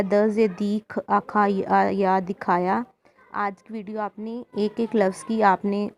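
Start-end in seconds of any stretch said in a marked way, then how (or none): 0.0-2.8 "दिखाया" built up syllable by syllable, "द से दी, ख आ खा, य आ या", then the whole word; spelled out as taught. Shown as a demonstration. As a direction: spell it out first, then join it up. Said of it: "आपको जैसे करवाया". ("दिखाया" पहले हिज्जे करके, फिर पूरा शब्द)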